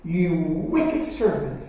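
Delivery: slow, heavy rebuke of the servant; stern master. Acted. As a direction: weigh each word in judgment. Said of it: "said to him".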